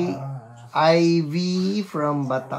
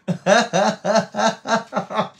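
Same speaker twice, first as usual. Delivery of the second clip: sustained higher-pitched laughing run; real hearty laugh; 6 semitones higher, 3 dB louder than usual. Real, not acted. Host joking that Ate Marie is so blessed, hearty laugh. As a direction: keep laughing in a long bouncy burst.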